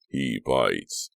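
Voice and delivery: low-falling, deadpan caution; mock warning. A narrator's reading. Billy jokes about the roadie, mock warning. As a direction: drop it like a grave secret. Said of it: "Billy warned".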